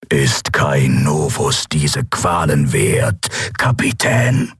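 low, very slow villain voice, rising on the last word; drawn-out low creature voice; sinister interrogator. A narrator's reading. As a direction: question the captive slowly and coldly. Torturer questions the captive captain.

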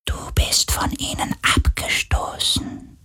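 soft eerie whisper of a sea spirit; wraith whisper. A narrator's reading. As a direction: hush it like a seductive ghost. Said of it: "flüsterte der Geist des Meeres".

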